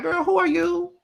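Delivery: very high, mocking who-are-you voice; shrill mock indignation; sassy put-down. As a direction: look her up and down with your voice. Retort to Kayla again.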